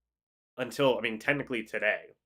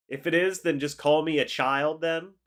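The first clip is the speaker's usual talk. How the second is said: ordinary talk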